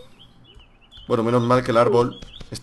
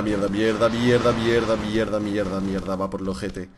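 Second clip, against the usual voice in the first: softer mutter of curses; cursing under breath; 6 semitones lower, 7 dB quieter than usual. Real, not acted. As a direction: swear quietly to yourself. Cursing, fading out.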